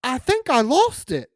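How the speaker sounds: high, loud alarmed cry; high-pitched squeal of dismay; panicked girl. Retold or imitated. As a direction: play her sudden panic big. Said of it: "and says".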